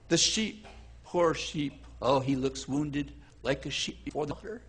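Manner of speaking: low, soft cooing over a victim; mock pity. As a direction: feign sympathy in a small, low voice. Mock pity for the bait sheep.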